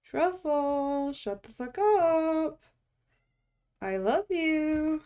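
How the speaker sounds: high, slow singsong to a cat; drawn-out pet-talk voice; cooing at pet. Real, not acted. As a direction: stretch the words out sweetly high.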